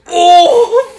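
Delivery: very high, loud "ohh!"; hammed-up bystander's howl; mock shocked cry. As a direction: react as if someone just got burned. Bystander's reaction to the insult.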